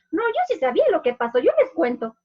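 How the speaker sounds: put-on child bragging to the class, eager. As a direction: show off that you know the ending. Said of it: "Otro dice".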